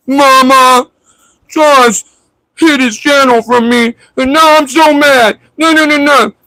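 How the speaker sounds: put-on high, wailing mama's boy; mock crybaby. Streamer mimicking JP running to his mama, high mock wail. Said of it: "now you gotta go run back to mama's lap".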